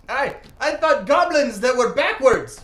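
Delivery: high, loud, drawn-out report; excited outburst. Acted. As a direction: blurt out the wild news, stretching it. Character excitedly reports his odd adventure.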